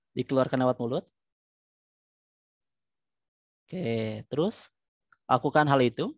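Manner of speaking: calm guided breathing voice; soothing spa whisper. Acted. Leads breathing relaxation exercise.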